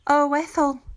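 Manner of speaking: un «oh» alto y dolido; horrified. A narrator's reading. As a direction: dilo horrorizada y culpable. Mildred horrified at her spell.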